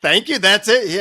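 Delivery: high, loud acted protest; indignant disbelief. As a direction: protest like a cheated customer, playing it for laughs. Acted outrage at the guru.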